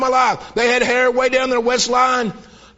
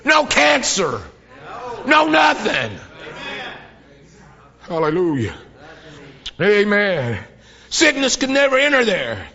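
building preaching; slower than usual. Real, not acted. Preacher describing heaven to church, building to a shout. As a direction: climb each line toward a shout.

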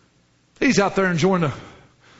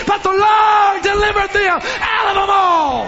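full-force preaching; 8 semitones higher than usual. Acted. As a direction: build it like a revival peak, voice ringing.

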